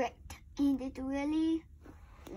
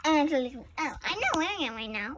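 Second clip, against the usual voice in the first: ordinary talk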